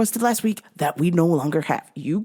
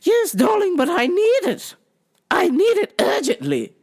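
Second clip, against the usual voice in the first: high, pleading English darling; high mock-English customer insisting; phony English accent; 12 semitones higher than usual. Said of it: "phony English accent".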